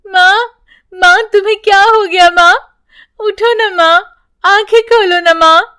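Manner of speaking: put-on high, urgent child's begging; frightened girl begging mother; pleading child. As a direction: plead with her to wake up. Child begs unconscious mother.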